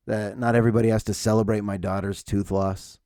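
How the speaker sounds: ordinary delivery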